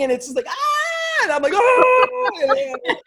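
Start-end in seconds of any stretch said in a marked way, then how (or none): 0.5-1.2 very high mock shriek; lizard's scream. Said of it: "screaming at each other". 1.5-2.3 loud, high mock scream of fright; retold answering scream. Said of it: "screaming at each other".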